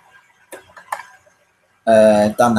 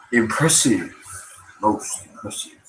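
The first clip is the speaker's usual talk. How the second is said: breathy, fading Vader line; a hint of Vader impressed; Darth Vader; 3 dB quieter, slower than usual. Streamer keeps trying Vader lines. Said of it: "I can't really do Darth Vader".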